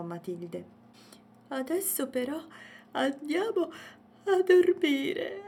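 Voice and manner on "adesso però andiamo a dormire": high, stretched words swelling into a yawn. A narrator's reading. Poldo sends Matilde to bed, yawning. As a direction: talk through a big acted yawn. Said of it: "disse Poldo sbadigliando".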